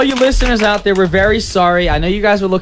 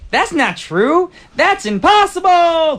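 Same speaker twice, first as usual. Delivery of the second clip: slow, higher hammed-up denial; Luke's denial; 8 semitones higher, slower than usual. Time traveller refuses the Vader news, slow hammed-up denial. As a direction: stretch it out like Luke on the gantry.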